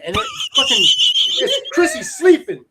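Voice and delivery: real laughter breaking through the words; high, laughing outburst. Real, not acted. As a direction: crack up mid-sentence. Laughing at his own mix-up.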